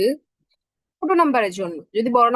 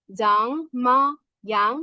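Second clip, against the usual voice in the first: very slow "জাংমায়াং" in separate syllables; much slower than usual. Shown as a demonstration. Teacher breaks the word down for learners, very slowly. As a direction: stretch out "জাং", "মা", "young" one by one.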